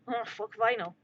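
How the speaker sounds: normal talking voice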